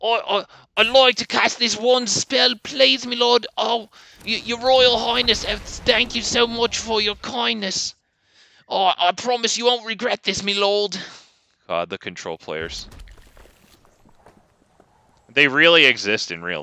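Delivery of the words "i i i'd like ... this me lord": high, groveling peasant voice; medieval peasant. Acted. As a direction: beg the lord for scraps, high-pitched. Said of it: "Medieval peasant voice begging the lord for scraps".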